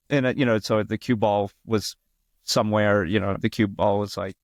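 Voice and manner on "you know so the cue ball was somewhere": ordinary talk, nothing marked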